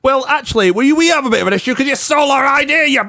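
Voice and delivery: very high, hammed-up complaining phone voice; Tetsujin caller. Podcaster voicing Tetsujin, very high and fussy on the phone. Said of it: "it's Tetsujin here".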